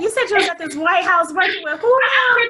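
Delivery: high, loud stunned shout; amazed disbelief. Real, not acted. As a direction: stop her in amazed disbelief.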